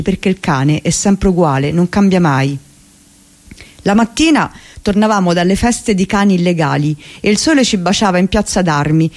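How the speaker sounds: plain reading voice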